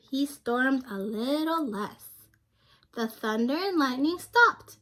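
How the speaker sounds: lively picture-book narration for a class; reading to children. A narrator's reading. Teacher reading a picture book aloud to her class. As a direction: read it like story time for young kids.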